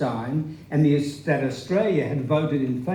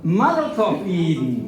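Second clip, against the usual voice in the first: a glad shout of good wishes; cheerful greeting; 2 semitones higher than usual. Retold or imitated. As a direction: call out congratulations to a friend. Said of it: "was the repeated greeting".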